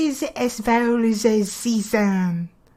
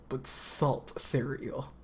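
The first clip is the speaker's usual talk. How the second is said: normal talking voice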